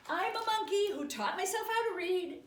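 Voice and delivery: loud, high, hammed-up monkey character. Reader forced to be a monkey, loud silly character voice. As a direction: proclaim it loudly as a silly monkey. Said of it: "with a monkey mouth and my monkey voice".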